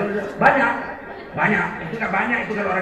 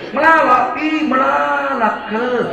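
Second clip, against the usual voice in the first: loud, drawn-out Karo exclamation, higher; Karo accent; 5 semitones higher, 6 dB louder, slower than usual. Retold or imitated. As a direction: shout it like a Karo speaker. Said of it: "Kalau bahasa Karo". (logat Karo yang lantang dan diseret)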